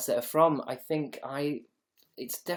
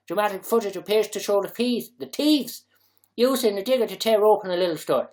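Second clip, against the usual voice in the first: put-on higher, louder Dublin accent read; 5 semitones higher, 5 dB louder than usual. Streamer retelling the digger footage, animated Dublin accent. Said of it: "put Dublin accent into".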